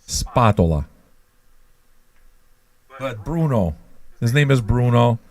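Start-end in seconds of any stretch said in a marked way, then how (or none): 0.1-0.9 low-pitched Spatola, SPAH-toh-lah; Italian pronunciation. Shown as a demonstration. Proving he is Italian, Spatola as low SPAH-toh-lah. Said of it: "You know how I know I'm Italian".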